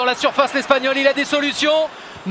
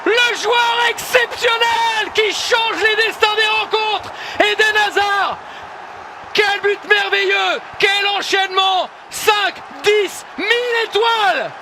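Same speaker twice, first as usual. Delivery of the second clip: excited sports commentary; 6 semitones higher than usual. Real, not acted. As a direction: stretch the praise out, awestruck. Wonder goal, commentator erupts.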